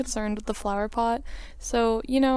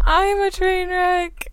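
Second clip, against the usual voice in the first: mock self-pity; 7 semitones higher, 4 dB louder, slower than usual. Player jokes about herself.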